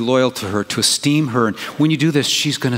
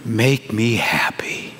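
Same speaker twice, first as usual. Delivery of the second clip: husband whispering sweetly to his wife; tender husband whisper; 2 semitones lower, much slower than usual. Retold or imitated. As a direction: breathe it tenderly to her. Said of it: "Your husband says".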